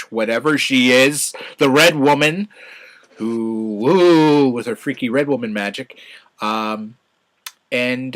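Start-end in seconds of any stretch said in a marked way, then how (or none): 3.8-4.5 loud, higher mock ghostly woo; playful high woo; spooky woo. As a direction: make a silly spooky magic sound. Mocking the Red Woman's magic.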